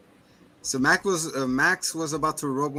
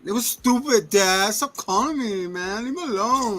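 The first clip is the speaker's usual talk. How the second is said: high-pitched mock teen tantrum; whiny teenage son; 8 semitones higher than usual. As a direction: whine at dad like a sulky teenager. Voices sulky son answering dad.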